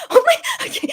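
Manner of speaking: high gasp breaking into laughter; gasp, then laugh it off. Real, not acted. Vlogger overwhelmed by mom's wish, gasping laughter.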